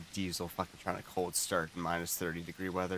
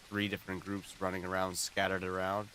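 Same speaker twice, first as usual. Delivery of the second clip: ordinary delivery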